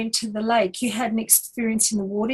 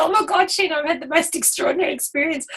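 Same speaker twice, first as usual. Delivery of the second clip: higher and faster, gushing friend; retold excitement; 6 semitones higher, faster than usual. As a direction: imitate the thrilled friend rushing out the news. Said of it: "she was so excited".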